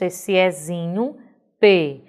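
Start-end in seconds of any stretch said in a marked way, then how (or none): 1.6-2.0 "P" said pê, with a closed e; a firm, louder "P" as pê, not pé; closed vowel. Shown as a demonstration. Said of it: "ele não tem um som aberto". ("P" com e fechado: pê)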